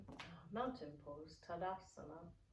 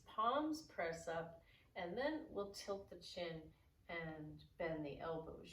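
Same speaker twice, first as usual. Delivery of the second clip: normal talking voice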